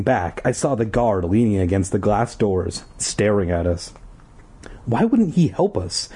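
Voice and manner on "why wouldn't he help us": higher-pitched angry demand. Acted. Girl furious at the guard, high angry demand. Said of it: "Ashley demanded in a high, angry voice".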